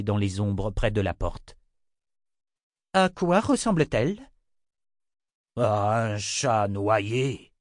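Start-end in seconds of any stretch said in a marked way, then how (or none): 2.9-4.3 sharp question. Acted. Sister questions innkeeper sharply. 5.6-7.5 a slow, grudging innkeeper. Acted. Innkeeper answering the sister, slow and grudging. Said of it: "répondit l'aubergiste de mauvaise grâce".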